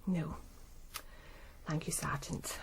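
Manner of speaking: barely a breath, whispered; whispered thanks. Acted. Widowed secretary thanking the sergeant, faint whisper. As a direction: voice almost gone with strain. Grieving woman answers sergeant.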